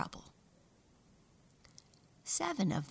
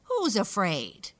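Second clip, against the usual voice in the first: scornful; 3 semitones higher, 7 dB louder, slower than usual. Acted. Boastful mouse mocks siblings.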